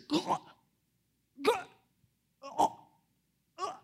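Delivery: short high choking noises; imitated baby gagging. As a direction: mimic a baby gagging, in separate bursts. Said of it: "so he gags all the time".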